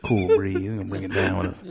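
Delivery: low, soft, laid-back jive; cool beatnik. Pretend. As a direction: ease it out like a jazz cat. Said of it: "He starts talking to Jive".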